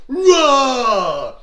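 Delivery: loud, high, falling battle roar; army's roar. Acted. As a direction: roar like a whole army. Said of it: "the Philistines would roar".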